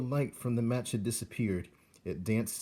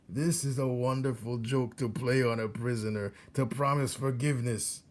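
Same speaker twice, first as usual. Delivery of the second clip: put-on higher, gloating old rat; old rat Botticelli; 3 semitones higher than usual. Old rat teaches young rat how to torture prisoners, gloating. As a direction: savour each cruel instruction.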